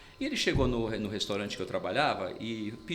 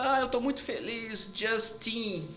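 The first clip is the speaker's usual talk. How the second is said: Sabrina Sato; 9 semitones higher, slower than usual. Retold or imitated. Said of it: "Ela falou".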